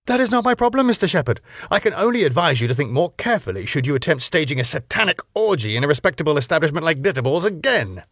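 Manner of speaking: high, fast, superior lecture; haughty club owner. Acted. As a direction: brush him off with contempt. Max voiced, dismissive.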